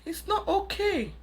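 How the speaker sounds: firm correction, not okay pitched high; stress on NOT. Real, not acted. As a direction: lean hard on "not" in "It's not okay". Scolding parents who excuse children.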